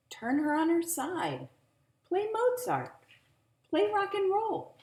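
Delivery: high, loud busybody neighbor; neighbors' advice. A narrator's reading. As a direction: offer advice like a fussy neighbor. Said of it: "offered advice".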